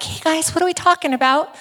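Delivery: high, quick voice of a tag-along; eager butting in. Retold or imitated. Disciple trailing behind Jesus and Peter, eagerly butting in.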